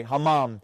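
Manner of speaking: "hammam" low, with both vowels open; corrected pronunciation. Shown as a demonstration. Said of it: "I'm saying it wrong".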